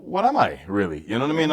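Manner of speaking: normal talking voice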